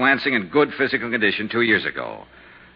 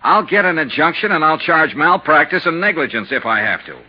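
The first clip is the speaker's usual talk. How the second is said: heated threat; 5 semitones higher than usual. Acted. Investigator threatens the doctor.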